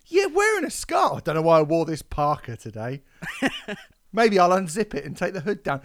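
high, loud mocking voice of the overdressed woman; mocking the scarf woman. Host mocks the woman in a scarf, high and loud. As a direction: sneer it out in her silly voice.

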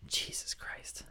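breathy whisper to herself, mortified; whispered exasperation. Real, not acted. After mixing up the actors, whispered in embarrassment. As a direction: let it slip out under the breath.

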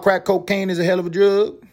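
hammed-up quoted catchphrase; quoting in a voice. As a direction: deliver it like a famous TV quote. Quoting famous line in voice.

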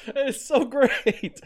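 high giggle running into slow, delighted words; laugh, then stretch the words out through it; real laughter. Real, not acted. Fan relishing the old coach's rant, laughing delightedly. Delighted after the played Mora clip.

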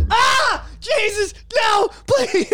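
high hammed-up cries for mercy; mock pained pleading. Host begging Mickey Mouse to stop, mock crying. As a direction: beg like you're being beaten, hammed-up.

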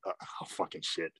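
ordinary talk, nothing marked

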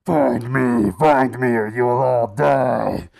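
loud, slow, booming command from a giant skull; menacing loud spectral summons. Retold or imitated. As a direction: thunder it out like a ghostly hologram.

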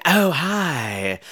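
low, hushed seductress; drunken mother's quiet come-on; seductive purr. Acted. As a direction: purr it softly and low, flirting. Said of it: "She starts trying to seduce the fiancé".

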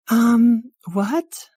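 very high, loud put-on voice; baffled bystander. Bystander puzzled by talk of vacuums, high baffled voice. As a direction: pipe up in a high questioning voice.

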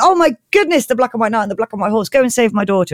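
high, hammed-up amazement at first, settling; mock awe tumbling out quickly; overawed king. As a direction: play a thrilled storybook king.

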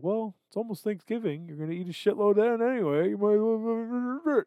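the sly voice in his head that talks him into overeating; a mock devil on the shoulder, pushing fast and then slowing on the last words; tempting inner voice. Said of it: "in my mind, I'm like".

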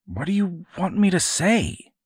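put-on a cornered kid, exasperated; pleading boy.

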